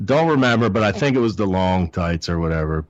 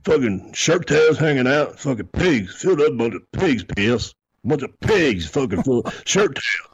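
disgusted rant; 6 semitones higher than usual. Retold or imitated. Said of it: "And he's like".

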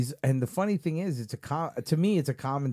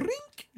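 imitated snap sound; 7 dB quieter than usual. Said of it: "it was always like".

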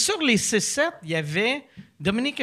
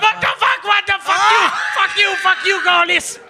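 high loud frightened yelling; 11 semitones higher, 5 dB louder than usual. Retold or imitated. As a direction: scream in panic at the stranger. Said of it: "je me mets à crier".

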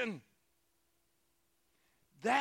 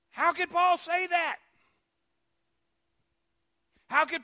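preaching at full force; 5 semitones higher, 8 dB louder than usual. Acted. Preacher proclaiming Paul's words to the church, shouting. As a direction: thunder the scripture at the congregation.

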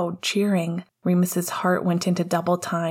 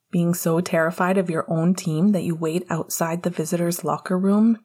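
ordinary delivery, nothing marked

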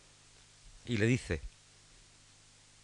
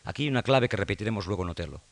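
ordinary talk

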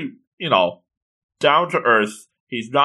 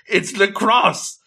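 high, drawn-out gushing announcement; hammed-up thrill, slow and high; mock excitement; 8 semitones higher, much slower than usual. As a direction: imitate an over-excited girl naming her sport. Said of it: "she's all excited".

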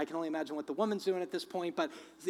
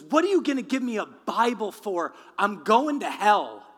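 loud, raised-pitch angry outburst; super angry; 5 semitones higher, 11 dB louder than usual. Retold or imitated. Mugger handed a Bible by his victim, acted angry shout. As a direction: act out an indignant yell. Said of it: "gets super angry".

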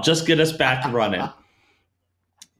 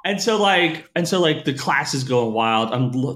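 normal talking voice

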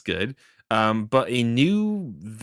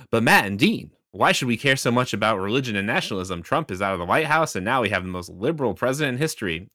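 ordinary talk

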